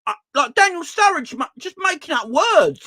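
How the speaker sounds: indignant, raising it to almost a shout; fed-up fan ranting at pundits; exasperated high rant. Real, not acted. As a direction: voice climbing high and loud in outrage.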